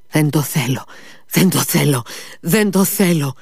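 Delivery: each repeat climbs toward a heartbroken shout; anguished cry. A narrator's reading. Sick boy refuses the dog, building anguished cry. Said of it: "φώναξε με σπαράγμο".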